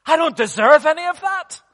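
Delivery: high, loud, building testimony; fervent preaching. Real, not acted. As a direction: cry it out from the pulpit. Preaches at full force.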